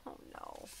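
breathy whisper; whispered dismay. A narrator's reading. Reader whispers in dismay after the crash.

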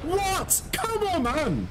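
very high-pitched incredulous outburst; exasperated protest. Real, not acted. Gamer protesting at the game mid-fight, exasperated. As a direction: protest in a high, disbelieving yelp.

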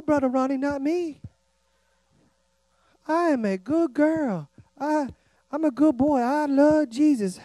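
soft, slow, sugary fake-holy voice with a fake sob; mock pious churchgoer. A churchgoer insisting to Brother Ronnie she's a good girl, mock sweet and teary. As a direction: ham up an innocent good girl.

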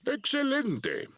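very high excited exclaim; squeaky excited voice. Acted. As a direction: squeal it with glee. Grandpa pig answers high.

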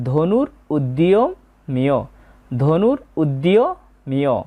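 ধনুর উদ্যমীয় very slow, দ half sounded before য; correct Sanskrit pronunciation. Shown as a demonstration. Teacher repeating the corrected word slowly for students. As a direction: stretch ধনুর উদ্যমীয় out, double য ফলা as ইয়. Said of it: "তাই এখানে হবে".

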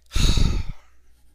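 long, loud, heavy sigh; exasperated sigh. Real, not acted. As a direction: let out a big fed-up breath. Fed up with the game.

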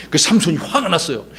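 ordinary delivery

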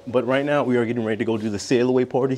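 ordinary delivery

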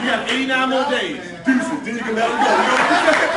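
loud, laughing mock dismissal; joking brush-off. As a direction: tease the crowd with a loud laughing kiss-off. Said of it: "I'm just playing".

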